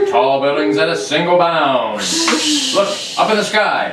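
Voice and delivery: put-on loud, punchy old radio intro; radio announcer. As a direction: boom it like a vintage announcer. Reads radio show opening.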